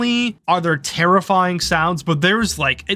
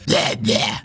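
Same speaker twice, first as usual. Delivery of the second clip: low, raspy voiceless demon bleh; imitated demon screams; 9 semitones lower than usual. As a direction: imitate little demons with two rough croaked cries. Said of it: "That was my best imitation of it".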